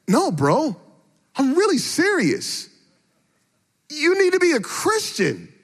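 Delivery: a son pleading with his friend in earnest; earnest plea. Retold or imitated. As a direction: press him, really serious.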